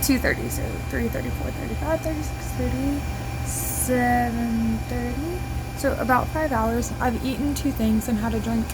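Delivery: tinny, distant phone mic; recorded on a phone, far off; phone recording. Real, not acted. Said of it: "Sorry for my phone quality".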